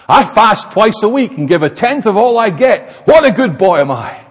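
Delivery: loud, self-satisfied boasting prayer, God shouted high; smug Pharisee. Acted. Pharisee boasting to God about himself, loud and smug. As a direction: pray like a proud show-off who thinks he's earned it.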